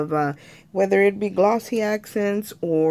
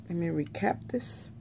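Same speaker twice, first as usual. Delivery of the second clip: whispered aside; 9 dB quieter than usual. Real, not acted. Muttered aside while recapping glue.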